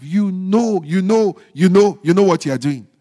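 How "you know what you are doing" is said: ordinary talk, nothing marked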